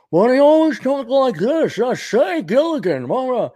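high, affected character voice; put-on voice, pitched well up; Winston Howell. Rich castaway talking to Gilligan, affected high voice. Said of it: "Winston Howell the third".

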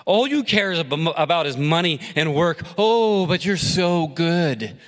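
high, mocking sneer, dragged slowly at the end; mock his goodness; wife ridiculing her husband's work obsession, sneering. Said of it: "She ridiculed".

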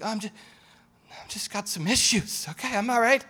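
high-pitched mock reassurance; acted self-excusing. Person excusing their own evil in the mirror, self-excusing.

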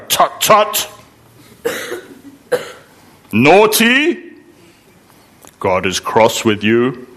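tutting, schoolmarmish reproach; mock scolding. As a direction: chide like telling off a small child. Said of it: "we'll go, go".

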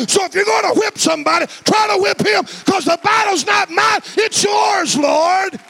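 impassioned declaration climbing into a high shout; full-force preaching. Real, not acted. Preacher defying the devil by his faith, high shouted preaching. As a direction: preach in driving, rising waves.

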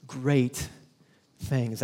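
Great, pause, things, slow and weighty; stress on Great things. Shown as a demonstration. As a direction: set apart each word, Great then things. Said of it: "repeated with pauses".

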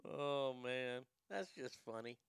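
ordinary talk

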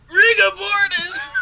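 high, slow, squealing disbelief; shocked shriek. Retold or imitated. Tommy hearing he has rigor mortis, shrieking in shock. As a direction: shriek it out like the news just hit. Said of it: "and Tommy's like".

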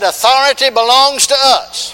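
loud, high preaching repeat. Acted. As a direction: ring it out for the church.

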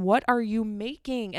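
usual speaking voice

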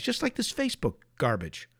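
normal talking voice

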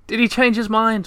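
high, alarmed question; dismay as the light vanishes; horrified question. A narrator's reading. Moomintroll asks the others, high and horrified.

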